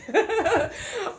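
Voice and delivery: loud, high burst of laughter; real laugh. Real, not acted. Joking about her new gray hairs, laughing.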